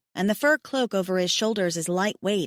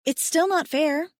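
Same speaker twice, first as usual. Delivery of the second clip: protesting; 7 semitones higher than usual. A narrator's reading. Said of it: "I protest".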